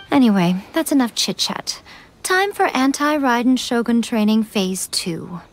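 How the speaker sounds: ordinary talk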